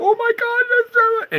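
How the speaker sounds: very high, loud shrieking panic; mock panic. Mocking future panicked people, shrieking. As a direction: shriek like you've just seen it. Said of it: "they go".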